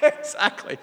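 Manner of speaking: airy laugh. Real, not acted. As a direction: laugh out the word. Laughs agreeing.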